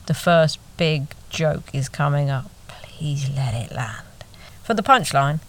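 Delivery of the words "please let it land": quiet, slow inner prayer; hushed plea. Retold or imitated. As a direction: beg under your breath, slowly. Silent plea before the joke.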